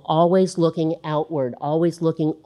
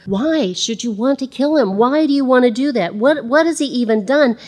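higher, urgent pleading; pleading Jonathan; 7 semitones higher than usual. Acted. Jonathan pleading with Saul, higher and urgent. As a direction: beg your father for reasons.

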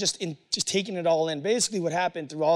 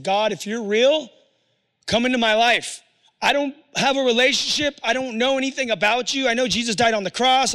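desperate prayer; 5 semitones higher, 6 dB louder than usual. Retold or imitated. Desperate prayer in hotel room.

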